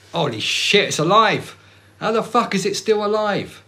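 high, loud alarmed shout; shocked shout. Acted. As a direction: yell in horror at the creature. Acted horror in skit.

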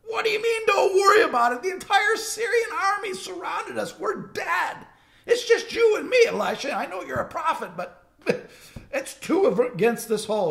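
high, loud frantic pleading with nervous laughs; panicked servant. Retold or imitated. Servant panics at calm Elisha amid the surrounding army, high and frantic. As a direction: squeal it like a scared kid who can't believe it. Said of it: "he looks at Elisha like".

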